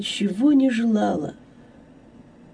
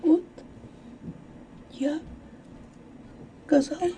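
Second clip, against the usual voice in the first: faint, halting near-whisper; shy, very soft admission; whispered confession; 8 semitones higher, 12 dB quieter, faster than usual. A narrator's reading. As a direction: breathe it out word by word, with long pauses. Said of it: "прошептала она".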